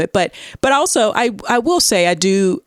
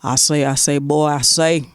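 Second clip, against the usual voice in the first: Foghorn Leghorn impression; 3 semitones lower than usual. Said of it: "sound like Foghorn Leghorn".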